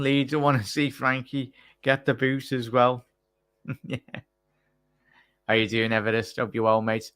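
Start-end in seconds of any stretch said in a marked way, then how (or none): none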